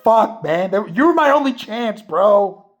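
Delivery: high, loud frustrated cry; despairing outburst. Retold or imitated. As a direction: wail at the friend who blew it. Bear despairing after Ian's wish.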